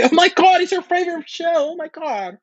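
very high, loud excited squeal. Pretend. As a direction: squeal with fan delight. Acting excited over TV.

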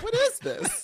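high incredulous squeak; baffled. Retold or imitated. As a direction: squeak it in disbelief. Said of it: "I'm like".

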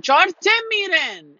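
retold angry exclamation, high and drawn out; upset outburst. Then-husband threatening to kill someone, upset outburst. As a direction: imitate an upset husband's loud, slow threat. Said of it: "got upset and smacked his hands".

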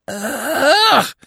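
high, loud wail of pain; bitter cry. A narrator's reading. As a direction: burst out in a piercing cry of terror. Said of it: "he cried bitterly".

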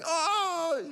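high, drawn-out moan; mock whining. Preacher imitating his own complaining flesh, high mock whine. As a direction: whine it out, hammed-up. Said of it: "I know the whining isn't gonna get me".

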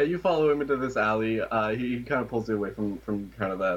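ordinary talk, nothing marked

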